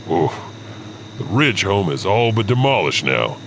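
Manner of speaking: usual speaking voice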